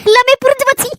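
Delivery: tearful, hurt mother breaking into sobs; wounded mother weeping after her son's rebuke; crying. Acted. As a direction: cry out your hurt, voice rising. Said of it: "சரி, அழாதீங்கம்மா".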